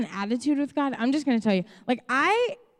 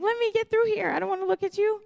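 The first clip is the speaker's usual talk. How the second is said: higher, hurried brush-off; brusque on a mission; 9 semitones higher, faster than usual. Retold or imitated. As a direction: push past people at the store, lightly voiced. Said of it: "on a mission".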